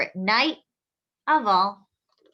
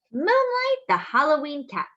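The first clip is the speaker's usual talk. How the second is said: bright, lifted title announcement; title read; 4 semitones higher, 5 dB louder than usual. Acted. Reading the title to children, bright announcer manner.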